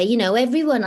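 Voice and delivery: ordinary talk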